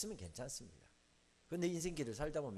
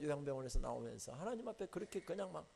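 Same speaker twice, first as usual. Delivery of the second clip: ordinary talk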